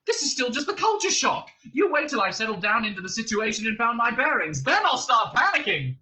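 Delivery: high, frantic insistence; Arthur, hysterical. A narrator's reading. Man insisting it is culture shock, hysterical. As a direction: climb toward a loud panicky peak. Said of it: "you're getting hysterical".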